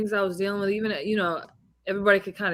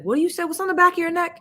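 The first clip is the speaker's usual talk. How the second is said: higher, pushy coach quizzing his fighter; hyped-up boxing coach; 10 semitones higher, 4 dB louder, much faster than usual. Retold or imitated. A coach quizzes his fighter before the bout, higher and pushy. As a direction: fire the question at her like a coach pumping her up. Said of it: "he's like".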